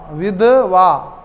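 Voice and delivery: विधवा said very slowly and drawn out; slow teacher's emphasis on विधवा; slow emphatic. Shown as a demonstration. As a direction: stretch विधवा for students to memorise. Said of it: "कपड़ा धोबा जाएवा".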